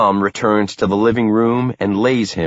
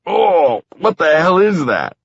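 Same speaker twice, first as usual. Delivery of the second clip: shocked disgust; 10 semitones higher, 3 dB louder than usual. A narrator's reading. Stench hits narrator.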